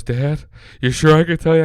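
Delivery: lightly mock a kid afraid to confess; hesitant child asking dad; nervous son. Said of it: "He's all nervous".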